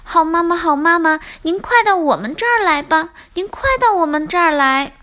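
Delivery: high, fast, excited little tadpoles; gleeful storybook children calling Mama over; overjoyed. A narrator's reading. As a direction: burst out with joy at finding mother. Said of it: "高兴地在水里翻起跟头来".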